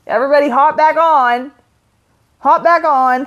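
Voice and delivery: calling out. Real, not acted. Calling viewers back to stream.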